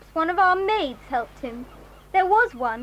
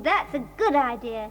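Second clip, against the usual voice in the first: ordinary delivery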